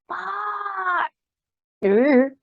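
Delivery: high burst of a swear then ooh; a sharp high yelp of a curse; sudden swear. Retold or imitated. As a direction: blurt out a swear in frustration. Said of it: "trying not to swear".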